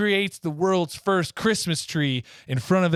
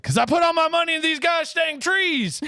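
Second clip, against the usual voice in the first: mock frustrated shout. Father yelling at his son about the trees. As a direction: shout it like a fed-up dad. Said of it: "And he's like".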